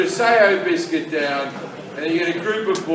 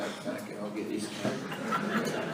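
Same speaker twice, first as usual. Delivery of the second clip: low, slow, half-whispered scheming; sneaky mutter; 12 semitones lower, 17 dB quieter, slower than usual. Retold or imitated. Comedian telling the crowd his secret plan, muttered low and slow. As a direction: mutter it to yourself like a plotter. Scheming aside before the prank.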